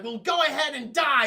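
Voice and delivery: hammed-up angry send-off; angry outburst. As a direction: shout it as a curse. Acted furious princess before the push.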